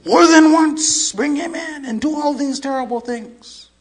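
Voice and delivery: high, loud outburst; anguished confession. Retold or imitated. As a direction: cry out the confession, imitating anguish. Confessing police chief's emotional outburst.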